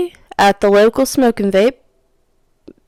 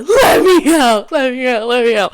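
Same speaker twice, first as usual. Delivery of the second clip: high, loud cry easing off; retold freak-out; 4 semitones higher, 3 dB louder than usual. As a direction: burst out in mock panic, then settle. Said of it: "freaking out".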